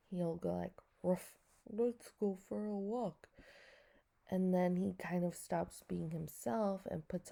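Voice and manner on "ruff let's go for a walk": goofy playful dog voice; funny dog voice. Retold or imitated. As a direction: talk like a silly pet dog. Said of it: "sometimes he talks in a funny dog voice".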